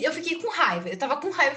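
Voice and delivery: ordinary talk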